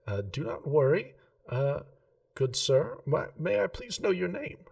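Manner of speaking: a hint of a prim gem-shop lady, keen on geometry. Remy asks customer's name.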